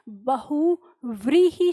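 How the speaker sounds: बहुव्रीहि said very slowly with a clear व; careful pronunciation. Shown as a demonstration. Teacher spells out बहुव्रीहि slowly, stressing the व sound. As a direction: linger on the व in बहुव्रीहि. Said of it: "सही उच्चारण है".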